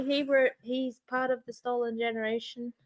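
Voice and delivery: ordinary delivery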